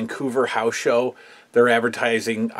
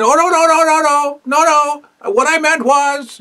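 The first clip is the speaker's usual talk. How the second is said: Piper backpedaling; 6 dB louder than usual. Retold or imitated. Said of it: "he'll, he'll do that".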